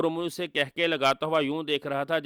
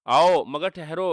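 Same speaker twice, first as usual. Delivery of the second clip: loud, slow call to stop; sudden halt; 3 semitones higher, 7 dB louder, slower than usual. Acted. Umru stops the sorcerer mid-charge, loud and slow. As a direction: hold him back with a shout.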